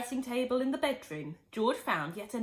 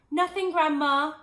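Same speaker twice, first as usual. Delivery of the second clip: raised, higher call to the next room; 7 semitones higher, 8 dB louder than usual. Acted. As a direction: call back loudly, innocent. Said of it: "he called out".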